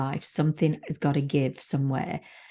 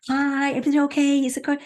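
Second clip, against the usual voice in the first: high, bright falsetto false cheer; chirpy puppet voice; 11 semitones higher, 3 dB louder, faster than usual. Retold or imitated. Guest acts her fake-happy puppet self, high and chirpy. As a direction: play the cheerful puppet keeping it together. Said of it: "what I call the puppet".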